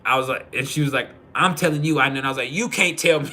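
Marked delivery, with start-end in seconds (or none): 1.3-2.0 high, louder mock insistence; retold insistent retort. Wife arguing back at husband over the starter, insistent. Wife's reply in retold argument. 2.5-3.3 high, loud mock defiance; retold cocky retort. Husband refusing wife's word in a retold argument, cocky.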